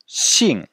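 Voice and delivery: xing dropping sharply from high; falling-tone xing. Shown as a demonstration. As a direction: teach xing with a steep fourth tone. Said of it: "se prononce". (xing au quatrième ton, qui tombe de haut)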